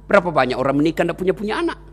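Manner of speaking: ordinary talk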